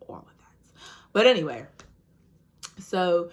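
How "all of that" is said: soft whispered aside. Real, not acted. Whispered aside to the viewers about the nurse.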